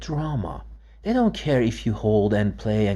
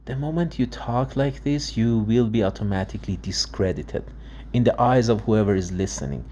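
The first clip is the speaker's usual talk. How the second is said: ordinary talk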